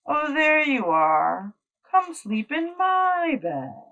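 high, tender invitation; tender welcome. Acted. Child invites the cat into bed tenderly.

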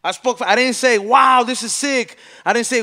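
fired up, dragging out the words; heated defence. Real, not acted. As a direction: hammer each line home, loud. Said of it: "defending himself, heated".

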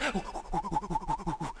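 rapid high huffing; mimed puffing of a sprinter; comic run of quick whoops; running fast. Acted. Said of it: "Elijah's running so fast".

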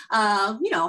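usual speaking voice

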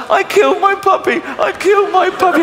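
very high hammed-up child's cry; wailing child. Children blaming themselves for the puppy, high mock wail. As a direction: wail the guilt like a distraught kid.

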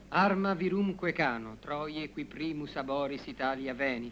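slow recitation of verse; reciting Latin. Acted. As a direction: drone the Latin slowly to stay calm.

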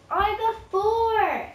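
high, loud, slow cheer; excited shout. Real, not acted. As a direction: crow the score out. Repeated triumphant shout.

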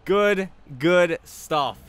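loud, high, stretched-out approval; elated cheer. Real, not acted. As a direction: savor the goal loudly. Still celebrating the goal.

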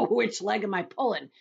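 loud laughing tease; laugh, then crow the question; acted hysterics. A narrator's reading. Centipede teasing the earthworm with glee, laughing. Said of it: "sent the centipede into hysterics".

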